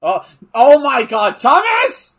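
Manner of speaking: high, loud excited call to a friend; excited shout. Real, not acted. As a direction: burst out in excitement. Excited at sleeper hold.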